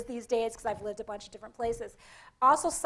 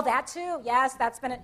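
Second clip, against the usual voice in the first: usual speaking voice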